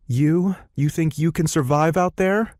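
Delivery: high, rising disbelief; incredulous. A narrator's reading. Vanessa doubting small Lily can survive, high-pitched incredulity. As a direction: question her with scornful surprise, pitched high.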